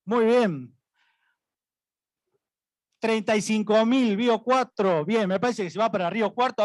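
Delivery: brisk rising bid calls; auctioneer. Acted. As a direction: call the bids like an auctioneer. Auctioneer calling bids. (pregonero de remate)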